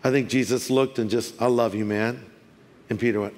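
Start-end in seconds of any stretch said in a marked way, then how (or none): 1.4-2.2 low, soft, tender reassurance; loving and compassionate. Acted. Imagining Jesus looking at failed Peter, tender and soft. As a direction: say it gently, with compassion. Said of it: "with love and compassion".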